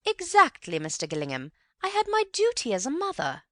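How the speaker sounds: very high, loud self-righteous mother; proud mother. A narrator's reading. Mother proudly confirms her duty to Antony, high and loud. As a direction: trumpet your duty.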